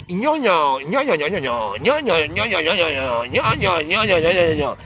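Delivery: swooping nasal-sound "gna gna" gibberish; mock affected babble. Vlogger mimicking someone phoning home after arriving, mocking swooping babble. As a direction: imitate affected chatter with rolling rises and falls.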